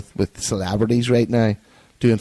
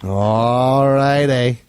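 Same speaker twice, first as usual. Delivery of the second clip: very slow, stretched All righty; drawn out; much slower than usual. Pretend. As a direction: drag out All righty lazily. Sign-off repeated.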